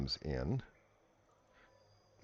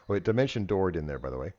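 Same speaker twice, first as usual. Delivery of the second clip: ordinary talk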